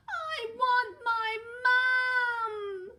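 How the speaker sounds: very high, loud, slow wail; wailing child. Acted. Eddie trapped by the bear, slow high loud wail. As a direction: wail for your mum.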